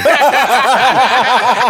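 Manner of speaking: loud, high burst of laughter; real loud laughing fit. Real, not acted. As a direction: crack up hard.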